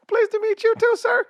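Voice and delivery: very high, thin-pitched polite reply. Retold or imitated. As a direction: squeak it out far above your own voice. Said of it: "He made me sound like [name]".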